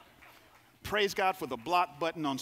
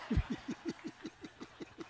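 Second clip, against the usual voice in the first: soft breathy chuckle into the mic; a light real laugh; 6 semitones higher, 12 dB quieter than usual. Real, not acted. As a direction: let out a quiet airy laugh. Pastor laughs at own joke.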